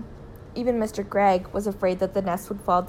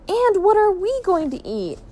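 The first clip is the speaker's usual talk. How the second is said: high, louder wail; anguished question, pitched up; dismayed; 11 semitones higher, 5 dB louder than usual. A narrator's reading. Family shut out of the house with no food, dismayed.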